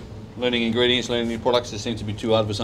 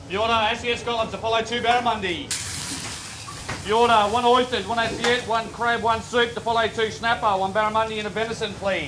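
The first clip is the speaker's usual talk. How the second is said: kitchen order callouts; 8 semitones higher than usual. Real, not acted. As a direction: call the order to the line. Chef calling orders.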